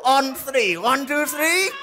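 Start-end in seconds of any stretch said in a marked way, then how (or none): none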